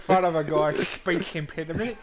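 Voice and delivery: loud, slow, through laughter; mock-offended, laughing hard; laughing protest. Real, not acted. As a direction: scold a mate while cracking up. Laughing at being teased about speech.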